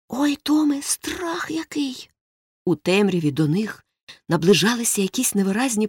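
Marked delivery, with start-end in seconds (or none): 0.1-2.1 breathless scared whisper, slowing down; frightened whisper. Acted. Boy hiding in the graveyard whispers to Tom, frightened whisper. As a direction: hush it out, terrified of being heard.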